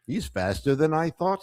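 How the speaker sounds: usual speaking voice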